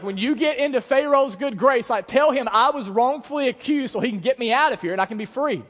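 high, loud, driving sermon voice; full-force preaching. Real, not acted. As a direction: preach it to the back row.

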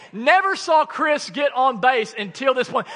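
usual speaking voice

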